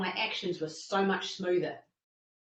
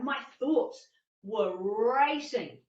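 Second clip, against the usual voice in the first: ordinary delivery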